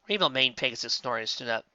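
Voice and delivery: ordinary delivery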